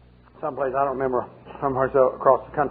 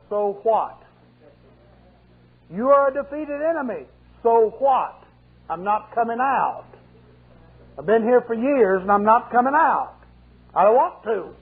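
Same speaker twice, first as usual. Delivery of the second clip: defiant demon; 5 semitones higher than usual. Retold or imitated. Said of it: "He says".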